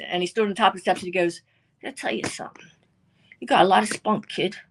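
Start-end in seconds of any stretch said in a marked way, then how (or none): 1.8-4.6 rough whispered tough-guy voice. Retold or imitated. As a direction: lean in like a wiseguy.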